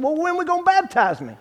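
impatient. Retold or imitated. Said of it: "I said".

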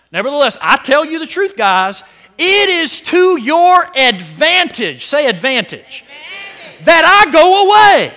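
high, loud proclaiming of the verse; loud scripture reading. Acted.

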